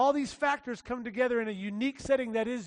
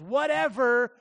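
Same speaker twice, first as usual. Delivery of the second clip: Whatever punched louder, held long; stress on Whatever; 6 dB louder, slower than usual. Shown as a demonstration. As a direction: lean hard into Whatever. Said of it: "everybody say whatever".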